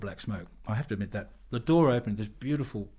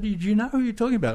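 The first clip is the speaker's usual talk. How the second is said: high, fast amazement; excited; 11 semitones higher, 5 dB louder, faster than usual. Retold or imitated. Poet amazed the Australian knows [name], rushing out a high question.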